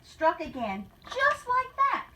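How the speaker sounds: rhythmic storybook read-aloud; story narration. Acted. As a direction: read the rhyme to the class with a lilt. Teacher reads picture book aloud.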